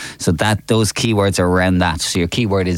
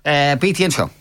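slow, higher game-show announce; announcer voice; 9 semitones higher than usual. Acted. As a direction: build up the segment like a quiz host. Radio host announcing quiz segment.

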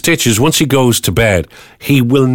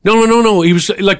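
ordinary talk

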